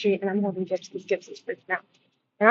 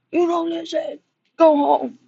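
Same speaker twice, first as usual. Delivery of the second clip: retold owner's yell, higher and louder; imitating a yell; 8 semitones higher, 5 dB louder, slower than usual. Owner yelling at his unleashed dog, imitated loud. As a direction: bark it at the dog like the owner did. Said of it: "he yelled at the dog".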